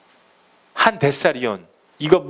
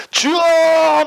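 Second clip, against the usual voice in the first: high, loud, wailing cry to the Lord; mock wailing cry; 13 semitones higher, 5 dB louder, much slower than usual. Dawn-prayer worshipper crying out loud to the Lord, mocked. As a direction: cry out to God as if sobbing. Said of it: "막 소리치면서 울면서".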